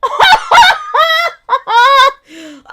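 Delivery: high, loud burst of laughter; loud shrieking laugh. Real, not acted. Host laughs at cheap Bud Light, loud cackle. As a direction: cackle with glee.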